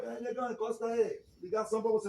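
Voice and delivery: mock prison buddy calling him over; imitating an inmate shouting across the yard.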